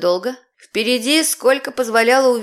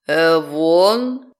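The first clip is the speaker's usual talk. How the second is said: demon voice; 6 semitones lower than usual. Acted. Demon appears.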